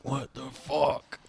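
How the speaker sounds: slow words through clenched teeth; talking through teeth. Retold or imitated. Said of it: "I'm talking through my teeth".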